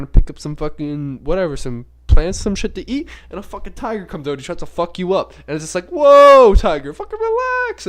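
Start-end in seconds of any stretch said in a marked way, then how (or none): 5.9-7.9 loud, high cry to back off; mock alarm. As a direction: yelp it in pretend panic at an attacker. Acting out alarm at attacking tiger.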